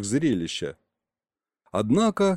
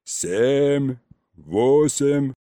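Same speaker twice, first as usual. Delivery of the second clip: drawn-out counting call, mildly raised; yelled count; much slower than usual. A narrator's reading. Uncle continuing the threatening countdown, mild yell. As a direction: keep calling the numbers out, stretched. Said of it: "И снова заорал".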